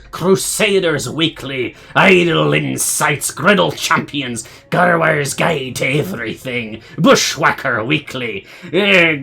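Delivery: loud, slow pirate announcer. Acted. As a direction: ham it up like a pirate captain. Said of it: "should do this in the pirate voice".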